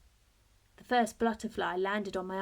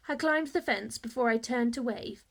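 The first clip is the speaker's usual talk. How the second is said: usual speaking voice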